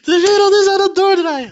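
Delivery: high, loud laughing squeal; hilarisch uitroepen. Real, not acted. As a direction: cry it out, cracking up at the absurdity. Exclaims in hilarity at absurdity. (hilarisch, gierend uitgeroepen)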